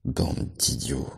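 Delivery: slow, whispered «Bande d'idiots!»; hushed scold. A narrator's reading. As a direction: hiss «Bande d'idiots!» under breath. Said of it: "cria Benjamin".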